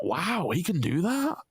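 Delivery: high-pitched retold astonishment; amazed. Co-host retelling hearing the streamer talk normally, amazed. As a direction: marvel at it, pitched well up.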